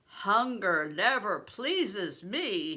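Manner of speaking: a giant's slow roar of a reply. A narrator's reading. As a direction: roar it out slowly at the boy. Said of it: "he roared".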